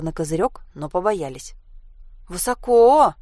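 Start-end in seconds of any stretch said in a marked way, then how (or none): 2.3-3.1 high, loud, stretched exclamation; drawn-out word. A narrator's reading. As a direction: stretch out the last vowel. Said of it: "протянула Анька". (протяжно, высоко и громко)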